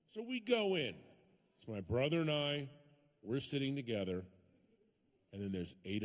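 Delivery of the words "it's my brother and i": usual speaking voice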